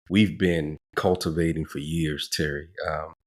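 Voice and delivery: ordinary talk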